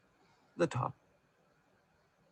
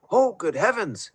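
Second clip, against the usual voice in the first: gasped; 9 semitones higher than usual. A narrator's reading. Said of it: "he gasped".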